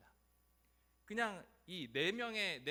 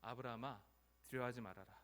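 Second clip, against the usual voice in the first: low, calm divine reassurance; God's voice; 5 semitones lower, 3 dB quieter than usual. Acted. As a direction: speak gently down to a frightened servant. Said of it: "하나님께서 처음 나타나서 이렇게". (낮고 차분하게)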